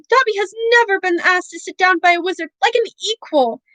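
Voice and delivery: choked elf cry. A narrator's reading. Dobby overcome at Harry's kindness, choked high cry. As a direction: gasp it through tears. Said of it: "choked the elf".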